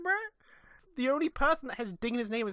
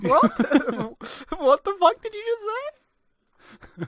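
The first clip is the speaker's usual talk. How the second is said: shocked disbelief; 8 semitones higher, 5 dB louder, much slower than usual. Real, not acted. Shocked by misheard words.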